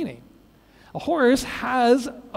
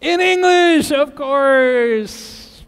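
loud, high, drawn-out answer; big mock-triumphant punchline; emphatic punchline; 4 semitones higher, 6 dB louder, much slower than usual. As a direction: belt out the obvious answer. Belts out the joke's punchline.

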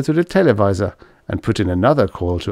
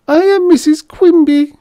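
put-on high squeaky little old lady; 7 dB louder than usual. A narrator's reading. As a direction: squeak it like a tiny granny. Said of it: "she announced in a squeaky voice".

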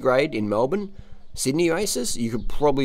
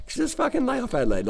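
high, squeaky lay off Adelaide; pitched-up exasperation; exasperated plea. Real, not acted. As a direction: beg them to stop, voice jumping high.

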